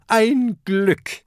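high, loud cry of relief; relieved high call. A narrator's reading. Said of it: "ruft Kokosnuss".

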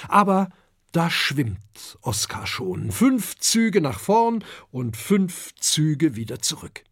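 plain reading voice

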